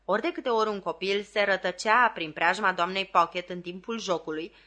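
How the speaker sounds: usual speaking voice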